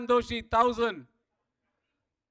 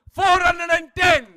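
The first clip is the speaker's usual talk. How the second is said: loud, higher auction call; shouted bid; 4 semitones higher, 8 dB louder than usual. Real, not acted. Auctioneer pushing the bidding to the room, shouting.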